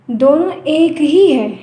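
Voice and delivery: ordinary talk, nothing marked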